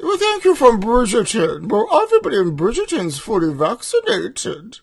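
put-on posh accent. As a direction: thank them in a fake posh English accent. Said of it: "the accent that you're using".

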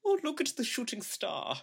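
put-on very high, wondering German accent; dreamy German accent. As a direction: marvel at the sky, German-accented. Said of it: "all of my German characters".

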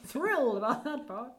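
light imitation of the armadillo voice; muffled inside armadillo shell. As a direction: echo the line as the armadillo. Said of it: "he's doing his, his armadillo voice".

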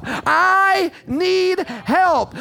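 high cry, each word hugely drawn out. Acted. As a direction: stretch every word into a long, high plea. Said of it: "I'm raising my voice".

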